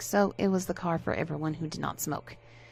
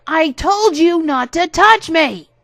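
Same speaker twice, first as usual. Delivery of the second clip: high, loud, slow furious yell; enraged shout; 10 semitones higher, 13 dB louder, slower than usual. Acted. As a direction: scream it down at him.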